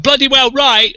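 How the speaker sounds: retold shout. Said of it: "run up to me and shout".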